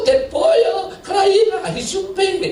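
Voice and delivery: high, excited shopkeeper voice; shopkeeper's voice. Retold or imitated. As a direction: chatter fast and high like the shopkeeper. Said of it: "saya katakan".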